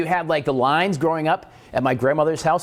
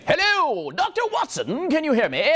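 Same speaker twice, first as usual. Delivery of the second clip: high, hammed-up English gentleman; mock English accent; 14 semitones higher than usual. Host imitating Bell's first call in English accent. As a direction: ham up a posh English inventor. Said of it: "Alexander Graham Bell saying".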